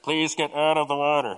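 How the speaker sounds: imitating a lifeguard on a loudspeaker; droning mock lifeguard warning; lifeguard announcement. Lifeguards warn a man in the surf, mock announcement voice. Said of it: "they're, they're like".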